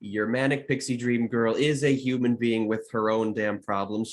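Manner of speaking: usual speaking voice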